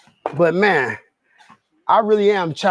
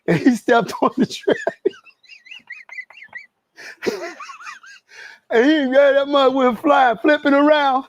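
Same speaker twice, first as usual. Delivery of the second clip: laughing through the words, pitch raised; cracking up; 9 semitones higher, slower than usual. Real, not acted. Telling viewers about a friend's treadmill fall, cracking up. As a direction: break into laughter while telling it.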